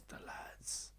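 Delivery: whispered catchphrase; hushed, airy delivery; retold line. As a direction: whisper it low for the close-up. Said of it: "Adam turns and he goes".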